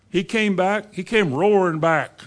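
ordinary talk

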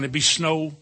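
ordinary talk

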